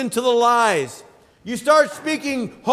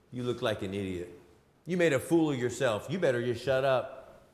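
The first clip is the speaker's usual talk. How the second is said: much lower and softer, a mocking devil voice; Satan's taunt; 10 semitones lower, 10 dB quieter than usual. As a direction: sneer it low and quiet like the devil. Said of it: "Satan came along and said".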